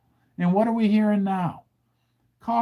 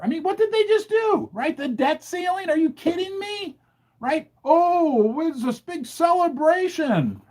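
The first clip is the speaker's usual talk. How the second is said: indignant outrage; 9 semitones higher than usual. Real, not acted. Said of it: "I'm mad".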